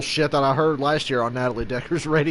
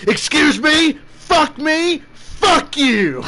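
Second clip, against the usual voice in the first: much higher, louder outraged yell in an imitated voice; Gordon Ramsay flipping out; 12 semitones higher, 4 dB louder, much slower than usual. Celebrity chef yelling back at the cook who cursed, high outraged shout. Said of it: "And Gordon Ramsay goes".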